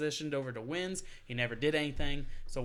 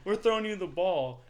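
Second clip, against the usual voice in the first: pretend coach call; 5 semitones higher, 5 dB louder than usual. Coach calling play.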